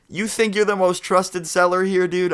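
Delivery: high-pitched scoffing retort; retold argumentative disbelief; incredulous challenge. Teller challenges seller's boast in retold quarrel.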